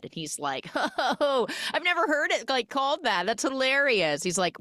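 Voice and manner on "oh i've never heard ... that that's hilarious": high-pitched imitation of amusement; mock amused. Driver amused by her word carpentering, mock amused. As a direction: laugh into it, imitating someone tickled.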